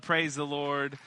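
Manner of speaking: normal talking voice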